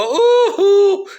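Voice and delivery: high, loud falsetto yoo-hoo; sing-song taunting call, way up high; shrill cartoonish yoo-hoo; mocking yoo-hoo.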